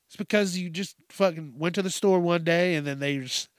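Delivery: normal talking voice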